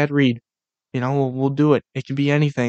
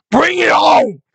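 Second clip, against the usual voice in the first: much higher, loud shout; challenging shout; 13 semitones higher, 5 dB louder, slower than usual. Pretend. Daring the TikToker on the field, loud shout. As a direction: yell the dare across the field. Imagined hit on football field.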